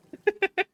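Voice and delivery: sudden high, loud laugh; real high laugh; burst of laughter. Real, not acted. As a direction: crack up loudly. Laughing at chat line.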